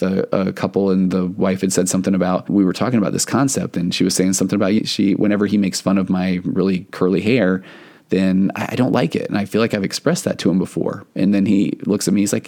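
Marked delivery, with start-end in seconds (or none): none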